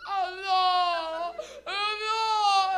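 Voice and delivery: high, loud, drawn-out mock bawling; crying father. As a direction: wail it like a hammed-up sob. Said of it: "he started crying".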